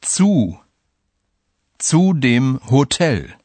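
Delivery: slow, careful demo reading; slow and clear. Shown as a demonstration. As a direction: say it slowly for learners. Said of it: "Listen to two examples".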